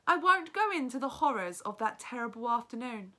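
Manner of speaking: lively read-aloud narration, teacher to class; story narration. A narrator's reading. Teacher reads the novel aloud.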